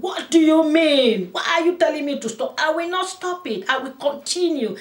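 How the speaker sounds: high, loud, angry outburst; retold angry smoker yelling; furious shout. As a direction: shout her down in fury. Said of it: "She got so angry with me".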